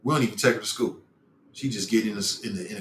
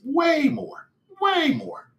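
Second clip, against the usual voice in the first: emphatic high; much slower than usual. Real, not acted. Emphatic insistence on radio bias.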